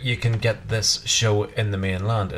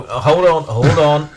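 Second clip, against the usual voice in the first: calls out hold on, loud and higher; loud calling out; 5 semitones higher, 6 dB louder, slower than usual. Real, not acted. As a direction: cut in loudly to stop him. Cutting in to correct husband.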